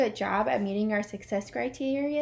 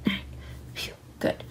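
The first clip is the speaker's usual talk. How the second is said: whisper; 9 dB quieter than usual. Acted. Relieved counting check.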